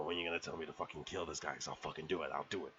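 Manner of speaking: low, quick, hushed dog's inner voice; eager attack dog. Retold or imitated. Dog waiting on Cliff's command, low and eager. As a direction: lightly voice a dog itching to pounce.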